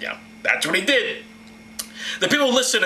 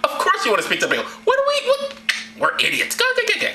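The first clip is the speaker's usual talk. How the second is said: very high, gushing mock agreement; sarcastic agreement. Guards giving in to the killer, sarcastically eager. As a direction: give in with exaggerated squeaky eagerness.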